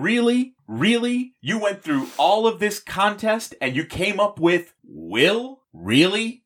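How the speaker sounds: high, mock disbelieving really; incredulous. Real, not acted. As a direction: sound utterly unimpressed. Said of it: "incredibly dull name".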